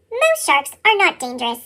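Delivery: usual speaking voice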